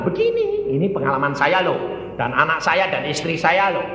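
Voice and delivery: normal talking voice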